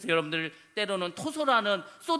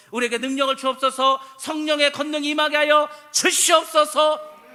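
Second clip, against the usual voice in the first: louder, higher, slowed pleading prayer; fervent cry; 5 semitones higher, 7 dB louder than usual. Shown as a demonstration. Pastor modeling a prayer of crying out, fervent and loud. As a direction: cry out to heaven for power.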